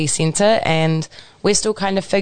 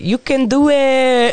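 high, drawn-out cheer; cheerleader cry; 7 semitones higher, 4 dB louder, slower than usual. Acted. Host showing her own way of supporting, high slow cheer. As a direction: cheer them on, stretching the words out. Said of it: "Honestly, mine is that".

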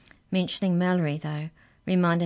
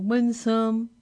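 higher, louder canine character voice; canine leader; 6 semitones higher, 5 dB louder than usual. Acted. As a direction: voice the canine, pitched up. Canine leader takes refusal.

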